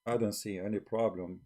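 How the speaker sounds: ordinary delivery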